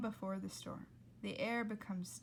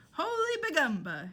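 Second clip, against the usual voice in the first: lightly voiced parakeet, higher and louder; Dolores the parakeet; 7 semitones higher, 8 dB louder than usual. Father shouting in a mild parrot voice. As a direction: squawk it with a light bird voice. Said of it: "in the voice of Dolores the parakeet".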